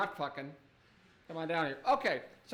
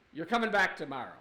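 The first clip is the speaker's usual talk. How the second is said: usual speaking voice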